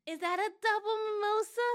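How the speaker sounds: high, loud mock curiosity; hammed-up high-pitched query; imitating nosy strangers. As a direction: mimic an excited stranger's question. Strangers' question voiced in story.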